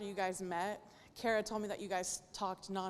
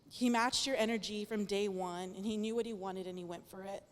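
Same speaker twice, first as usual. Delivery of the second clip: ordinary talk, nothing marked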